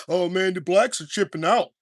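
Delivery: a hint of a gossiping online woman, mock tone. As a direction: lightly voice a scandalized internet poster.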